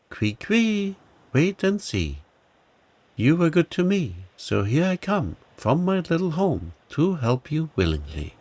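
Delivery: high squeaky little mouse, friendly and eager; bright high mouse voice offering help. Acted. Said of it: "said a friendly voice".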